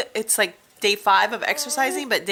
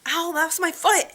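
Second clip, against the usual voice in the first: higher, startled yelp of pain; sudden ow; 9 semitones higher, 3 dB louder than usual. Real, not acted. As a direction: cry out as the foot gets hit. Foot stepped on.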